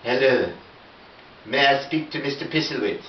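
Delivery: a hint of a posh French voice on the phone; French accent. Yves Saint Laurent caller phones the narrator, in a mild French accent. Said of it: "it's got the accent".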